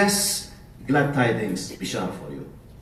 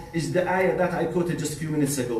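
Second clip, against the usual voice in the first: ordinary talk, nothing marked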